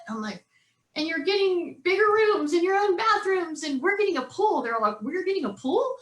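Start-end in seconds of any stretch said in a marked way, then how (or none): 0.9-4.6 put-on high, animated pitch to the kids; excited promise. Mother promising boys a new house, excited. 5.0-5.9 high, rising disbelieving question; amazed kids. Retold or imitated. Boys answering mom's pool promise, amazed.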